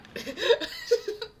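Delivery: loud high laugh bursting out; big real laugh; burst of laughter. Real, not acted. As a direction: crack up at the screen. Laughing at the meat throw.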